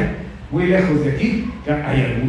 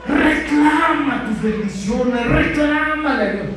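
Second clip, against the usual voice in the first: higher, slow preachy parody of demanding blessings; mocking bad preachers; 11 semitones higher than usual. False teachers urging people to demand blessings, mocking preachy tone. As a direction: send up preachers who teach claiming. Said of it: "Y dicen".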